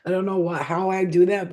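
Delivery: ordinary talk, nothing marked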